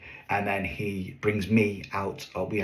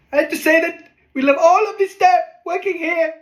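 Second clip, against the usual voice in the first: put-on much higher, louder shout in the comic foreign accent; 9 dB louder than usual. Performer without a mic yells the toast to seventy-five guests. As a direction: yell the line over a dead mic to the back row. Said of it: "It doesn't work when you're shouting it".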